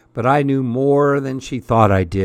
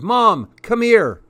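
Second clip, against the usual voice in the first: high, loud summons; yelling for Mom to come; tattling holler; 9 semitones higher, 4 dB louder than usual. A narrator's reading. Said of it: "she hollered".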